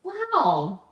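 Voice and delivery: high, loud, amazed wow; loud high-pitched wow; impressed. Real, not acted. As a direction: let it burst out, impressed. Impressed by the story.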